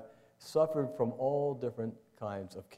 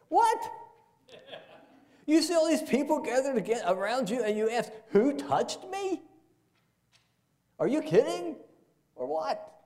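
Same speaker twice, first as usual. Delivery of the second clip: high, loud incredulous mock disbelief; disbelieving disciples; 13 semitones higher, 4 dB louder than usual. Disciples scoffing at Jesus in the crowd, high incredulous mock disbelief. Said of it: "That's just the way I think it's, that's how I respond".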